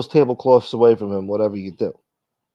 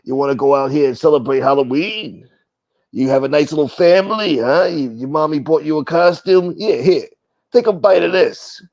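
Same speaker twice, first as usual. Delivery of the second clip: mock menacing creep; 3 semitones higher, 3 dB louder than usual. Creep handing a trick-or-treater a razor apple, mock menace. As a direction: taunt the kid like a horror villain. Acting out a menacing candy giver.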